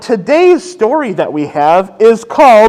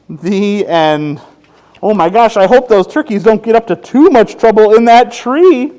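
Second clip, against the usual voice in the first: usual speaking voice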